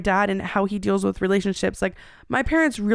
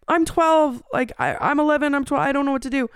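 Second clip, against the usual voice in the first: put-on panicked child; 6 semitones higher than usual. Child panicking on 911 call.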